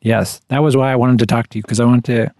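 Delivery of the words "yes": ordinary delivery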